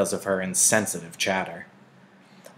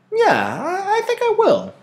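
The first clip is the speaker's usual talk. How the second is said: put-on much higher, eager child's reply; excited girl; 6 dB louder than usual. A narrator's reading. Lily eagerly agrees, childlike and excited. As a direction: jump at the idea. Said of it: "Lily said".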